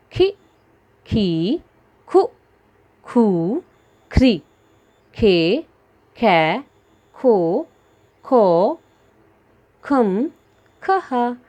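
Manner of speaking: teacher drilling ख syllables aloud; barahkhadi recitation. Shown as a demonstration. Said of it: "इसके बाद खा". (ख की बारहखड़ी ज़ोर से साफ़ बोलना)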